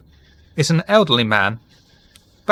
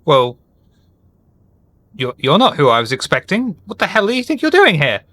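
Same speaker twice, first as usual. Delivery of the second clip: put-on indignant old man; 5 semitones higher than usual. A narrator's reading. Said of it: "and says".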